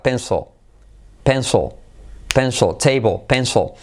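pencil as "penso", table as "teibo"; unfinished final L. Shown as a demonstration. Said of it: "al final no acaba pronunciándose". (pencil y table sin acabar la ele)

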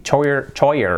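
choir wrongly as CHOY-er; pronunciación incorrecta. Shown as a demonstration. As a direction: guess at "choir" with a ch. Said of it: "que decía". (choir mal dicho, 'choyer')

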